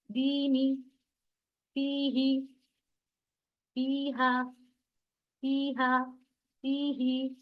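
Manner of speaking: level recitation, long ee in "दीनी" held two beats; stretched long vowels. Shown as a demonstration. Teacher modelling word by word, "दीनी" drawn out. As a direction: draw out "फी" in "फीही" and "फीहा" on an even note. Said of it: "इस तरीके से".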